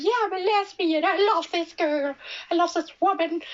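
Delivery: high, breezy blurted confession; mock Miss New York. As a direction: toss the line off brightly, like a parody. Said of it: "she goes and says".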